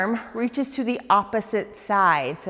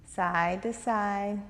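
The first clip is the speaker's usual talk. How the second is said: side to side drawn out slowly, soft; slow and calm; 4 dB quieter, much slower than usual. Shown as a demonstration. Teacher guiding students' hip sway, slow and soft. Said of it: "Nice and slow".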